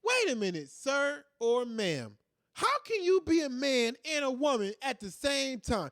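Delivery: mild kid impression; imitating a curious kid. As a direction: voice a blunt little boy asking. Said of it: "My son would have said".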